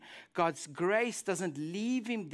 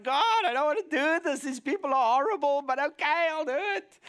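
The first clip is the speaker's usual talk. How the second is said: higher, louder whining complaint to God; whiny reluctant prayer; 6 semitones higher, 5 dB louder than usual. Retold or imitated. Jonah whining to God that he must go. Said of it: "that kind of feel, his prayer".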